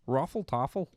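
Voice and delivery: light mock Canadian accent, rounded vowels; a hint of Canadian twang. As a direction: say it like a joking Canadian. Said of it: "Canada, eh?".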